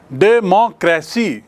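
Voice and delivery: डेमोक्रेसी slowly in four distinct beats; syllable by syllable. Shown as a demonstration. Teacher showing four vowels, डेमोक्रेसी split syllable by syllable. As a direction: separate each syllable of डेमोक्रेसी. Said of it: "चार बार इसमें vowel आ रहा है".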